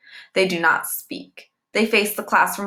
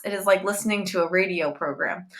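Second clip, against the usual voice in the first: ordinary delivery, nothing marked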